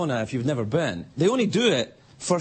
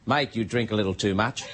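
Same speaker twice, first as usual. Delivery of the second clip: Australian bartender; 8 semitones lower than usual. Retold or imitated. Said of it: "the Australian bartender came over to me".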